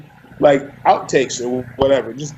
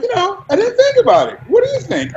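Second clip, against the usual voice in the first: much higher, light mock voice of the director; imitating Christopher Nolan; faster than usual. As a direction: play the director, high and breezy, tossing the question back. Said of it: "And he's like".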